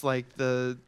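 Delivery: ordinary talk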